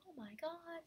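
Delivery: sudden high-pitched oh my god; shocked gasp. Acted. Robot finding out it isn't human, high shocked cry. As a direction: squeal out the shock of discovery.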